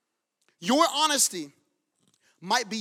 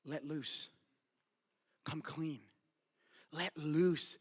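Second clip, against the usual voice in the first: soft, low exhortation; gentle urging; 7 semitones lower, 9 dB quieter, much slower than usual. Real, not acted. Preacher to people who have been searching, gentle urging. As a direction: urge it quietly, voice dropped low.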